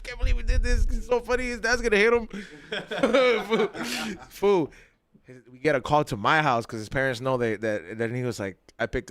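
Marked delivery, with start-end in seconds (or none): 0.0-4.7 higher, giddy with laughter; gleeful kids who got away with it; giddy laughing. Retold or imitated. Boys cracking up at the house, higher and giggly.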